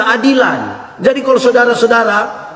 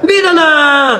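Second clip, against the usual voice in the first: emphatic shout; 8 semitones higher, slower than usual. Real, not acted. Preacher insists on the difference.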